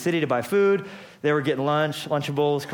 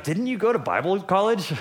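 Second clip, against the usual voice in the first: mock incredulous jab; 6 semitones higher than usual. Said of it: "Jesus literally was like".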